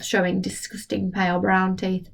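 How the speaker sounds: usual speaking voice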